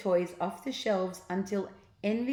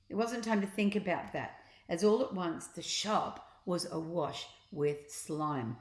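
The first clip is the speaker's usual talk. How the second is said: usual speaking voice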